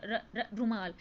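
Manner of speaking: र, र, रुमाल with the first sound repeated before the word comes out; stuttering demo. Shown as a demonstration. Teacher imitating a stuttering child saying रुमाल. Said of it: "बच्चे बोलेंगे र".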